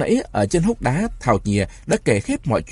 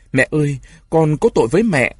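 crying out; 5 semitones higher, 4 dB louder than usual. A narrator's reading. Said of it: "bỗng bật tiếng khóc to".

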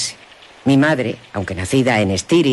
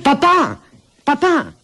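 llamada agudo; 12 semitones higher, slower than usual. Acted. Llama al padre de lejos.

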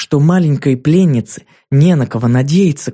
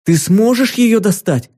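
put-on hopeful girl; 7 semitones higher than usual. A narrator's reading.

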